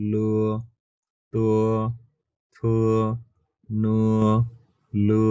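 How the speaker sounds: লু তু থু নু লু said one by one; row drilled with u glide. Shown as a demonstration. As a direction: say লু তু থু নু লু, each with a drawn u. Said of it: "লয় জ ফলা লয়ের মতো".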